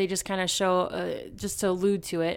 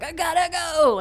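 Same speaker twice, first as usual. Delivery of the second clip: urgent Jerry; 8 semitones higher, 5 dB louder than usual. Retold or imitated. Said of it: "She's like".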